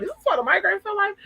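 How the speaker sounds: high-pitched, quick, baffled question; incredulous. Retold or imitated. Streamer re-enacts alarm at a first migraine, high and incredulous. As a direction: squeal it in mock disbelief. Repeats her reaction, now much higher.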